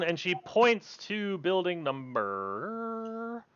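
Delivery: normal talking voice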